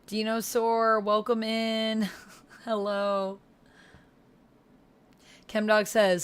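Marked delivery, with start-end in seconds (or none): none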